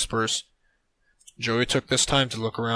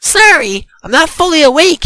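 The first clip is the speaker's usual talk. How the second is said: high, loud acted apology; loud excuse; 14 dB louder than usual. A narrator's reading. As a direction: blurt the apology loudly, pitched up. Son answers his mother, acted.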